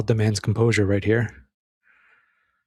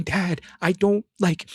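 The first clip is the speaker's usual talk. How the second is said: desperate plea; 9 semitones higher than usual. Retold or imitated. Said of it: "Nick is saying".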